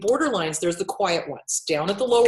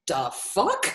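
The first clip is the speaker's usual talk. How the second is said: laughing disbelief; 6 semitones higher, much slower than usual. Retold or imitated. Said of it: "I was like".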